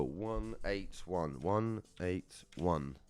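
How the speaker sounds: low, soft, drawn-out digits; slow reading out. Real, not acted. Host looking up the winning ticket number, slow murmured reading. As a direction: read each digit slowly, half to yourself.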